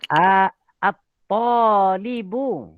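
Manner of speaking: at-talibu said very slowly with the final u drawn out; slow correction. Shown as a demonstration. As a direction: spell out at-talibu syllable by syllable, stretching the closing u.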